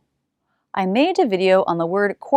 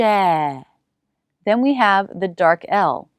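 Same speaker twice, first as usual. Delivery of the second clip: very slow explanation; much slower than usual. Shown as a demonstration.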